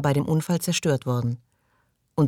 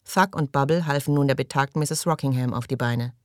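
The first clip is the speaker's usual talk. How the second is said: ordinary delivery, nothing marked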